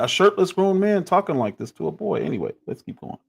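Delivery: usual speaking voice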